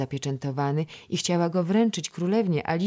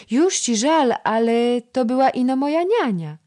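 put-on much higher, louder child's voice; chattering toddler; 10 semitones higher, 8 dB louder than usual. A narrator's reading. Toddler telling the princess about her play, high. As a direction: babble it like a little girl.

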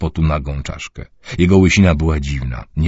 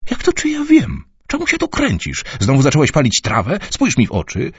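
much higher, sharp confronting voice; angry mother; 12 semitones higher than usual. Acted. Mother confronts son about smoking weed, angrily. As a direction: demand answers from her son.

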